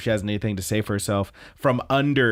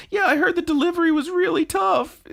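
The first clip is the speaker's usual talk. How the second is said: very high cartoon voice; hammed-up, high-pitched fretting; distraught concern. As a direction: squeak it in mock earnest distress. Mistaken character's distraught quote.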